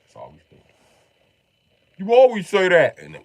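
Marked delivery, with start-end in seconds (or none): 2.0-3.0 high, loud mock accusation; exasperated retort. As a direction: fire it back, pitched right up. Retold argument about the weather.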